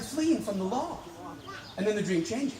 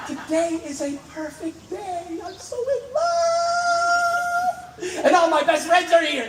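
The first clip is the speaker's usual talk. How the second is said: much higher, laughing, near tearful rapture; blissful bride; 7 semitones higher, 4 dB louder, much slower than usual. Retold or imitated. Bride's feelings flooding through the dreamer, laughing rapture. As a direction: swoon with joy, voice soaring up.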